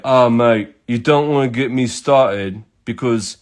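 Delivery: imitated English bloke, mate; hammed-up English accent; terrible English accent. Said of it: "do my terrible English accent for this".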